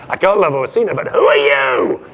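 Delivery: loud, hammed-up swoon, slowing on the question; smitten exclamation. Acting Olivia smitten by the messenger.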